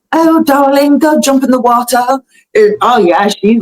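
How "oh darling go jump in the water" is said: higher-pitched put-on English accent; hammed-up English accent, pitched up; mock English accent. Host imitating the pop star's new accent, mock English. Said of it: "she speaks in an English accent only".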